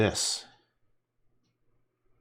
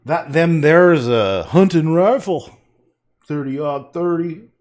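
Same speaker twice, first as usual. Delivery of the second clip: slow drawling backwoods hunter, a bit louder; 6 semitones higher, 10 dB louder, slower than usual. Pretend. Painter playing a backwoods hunter bragging about his rifle, slow drawl. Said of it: "talk like that?".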